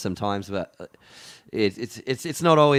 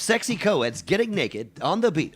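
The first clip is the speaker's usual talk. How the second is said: mock sleazy ad; 8 semitones higher, 5 dB louder than usual. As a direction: sell it like a sleazy late-night ad. Joke fake product plug.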